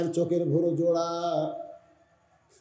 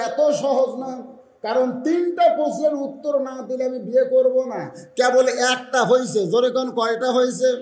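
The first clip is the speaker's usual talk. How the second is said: high, loud sermon delivery; raised, ringing preacher's pitch; full-force preaching; 9 semitones higher, 6 dB louder than usual. Real, not acted. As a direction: preach it out to a big crowd.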